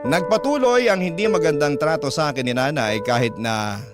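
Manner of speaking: normal talking voice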